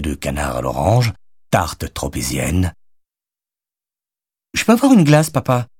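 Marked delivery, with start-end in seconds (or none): 4.5-5.7 put-on high, louder little-kid voice; pleading kid, pitched way up; child. A narrator's reading. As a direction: ask Dad sweetly like a small child. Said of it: "demanda Charlie".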